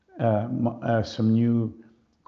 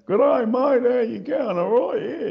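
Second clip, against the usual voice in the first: exaggerated broad Australian accent, pitched up; broad Aussie accent; 13 semitones higher, 5 dB louder than usual. Mocking broad Australian blokes with an exaggerated Australian accent. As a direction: ham up a broad ocker Australian greeting. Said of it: "Like that real kind of redneck way of speaking Australian".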